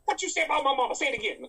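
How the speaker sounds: high, loud challenging Madea voice; an offended Madea about her mama; Madea impression. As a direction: dare them to say it again. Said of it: "Your mama".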